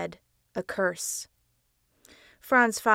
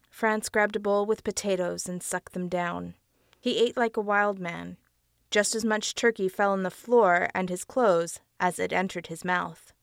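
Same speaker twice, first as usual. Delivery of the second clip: plain reading voice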